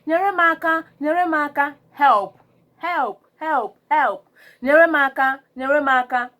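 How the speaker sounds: loud, high repeated cries of help; urgent calls for help. Shown as a demonstration. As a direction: call out for help over and over.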